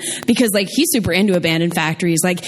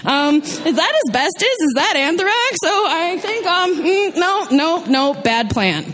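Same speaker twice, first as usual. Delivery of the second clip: high, fretful worried voice; nervous self on a tour; 7 semitones higher than usual. Retold or imitated. Me to a tour guide, worried and high. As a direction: squeak out the doubts, higher. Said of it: "I would be looking like".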